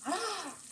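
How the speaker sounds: high, soft gasp of surprise; delighted gasp. Real, not acted. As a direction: gasp softly in amazement. Delighted by the cheese crust.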